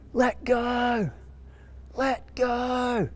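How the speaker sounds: high-pitched yell of let go; retold yelling. Friend yelling at the biting dog, high yell. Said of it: "s-, yelling".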